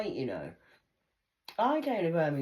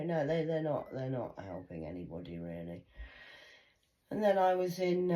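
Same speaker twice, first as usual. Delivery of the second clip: usual speaking voice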